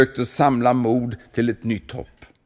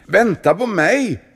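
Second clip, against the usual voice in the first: high, loud plea to wait; anxious call from below; 12 semitones higher, 6 dB louder than usual. Acted. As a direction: shout up after his friend. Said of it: "ropade munken".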